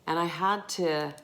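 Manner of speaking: normal talking voice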